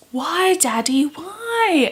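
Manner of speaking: put-on high pleading little girl's voice; crying child. A narrator's reading. Daughter begging her father at the funeral, high and pleading. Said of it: "Emma cried".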